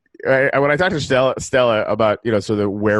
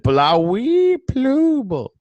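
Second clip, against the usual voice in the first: slow, high alien gibberish; 12 semitones higher, much slower than usual. Acted. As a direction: drawl it like a Martian. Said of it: "An alien once said".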